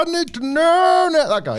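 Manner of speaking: high, slow mock yearning; mock eager. Real, not acted.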